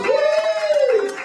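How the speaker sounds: high cheering yay. Real, not acted. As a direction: whoop the volunteer up. Cheering a volunteer up.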